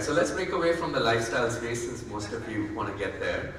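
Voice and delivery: ordinary delivery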